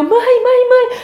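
high, loud call for mom; excited child calling her mother. Retold or imitated. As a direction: call mom urgently from the door.

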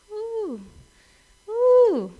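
drawn-out high whoop of "ooh". Acted. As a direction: wince at a tough one with high oohs. Reacting to how tough patience is.

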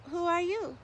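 curious little truckling. Acted. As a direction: ask like a curious small child. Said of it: "said the windmill".

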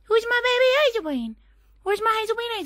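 squeaky high coo to the dog. Real, not acted. Owner cooing at her dog Hazelbean, very high baby talk. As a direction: coo way up high at your puppy.

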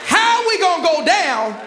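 loud, high, ringing preacher's climax over music; full-force preaching. Acted. Preacher rallying the congregation about the boat, shouting loud and high. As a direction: preach it to the rafters.

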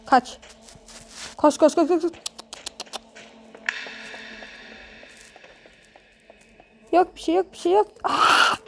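being chased. Real, not acted. Fleeing in horror game.

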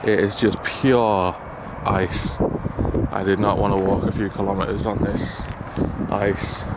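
ordinary talk, nothing marked

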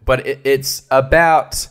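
normal talking voice

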